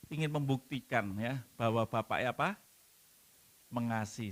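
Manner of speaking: ordinary talk, nothing marked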